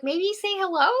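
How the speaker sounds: high, lilting suggestion; tentative suggestion. Retold or imitated. Blue suggesting a greeting to the trees, tentative high lilt.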